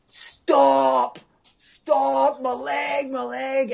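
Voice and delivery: high pitched desperate screams, re-enacted; retold screaming. Telling the interviewer how he screamed at the rider, high desperate yell. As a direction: shout it high as the leg gets smashed. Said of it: "I'm screaming at him".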